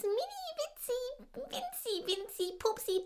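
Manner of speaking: high, squeaky tiny-voice for mini winzi; squeaky cute. Acted. Rosi minimising her fright in a squeaky voice. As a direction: play it down in a squeaky little voice.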